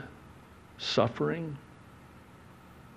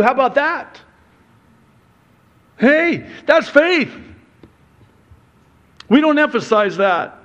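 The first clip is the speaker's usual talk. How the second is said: high, loud, fervent preaching; preaching at full force; 12 semitones higher, 5 dB louder than usual. Acted. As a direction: drive it home from the pulpit. Preacher at peak intensity.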